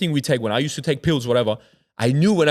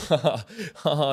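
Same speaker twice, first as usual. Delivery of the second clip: higher mock laughing; imitated laughter; 4 semitones higher than usual. Mimicking the driver who kept laughing, mock laughter. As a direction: imitate a friend who won't stop laughing. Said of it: "He started laughing".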